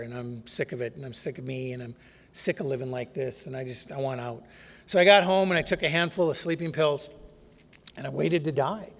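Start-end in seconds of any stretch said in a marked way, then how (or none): 0.0-4.4 very soft, drained and defeated; hopeless near-whisper. Retold or imitated. To himself on the walk back, soft and hopeless. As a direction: let the words sink almost to nothing, worn out. Despairing suicidal inner monologue.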